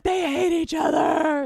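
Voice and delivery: high, loud gossip yell; mock outraged shout. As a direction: belt it like an outraged fan. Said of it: "being like".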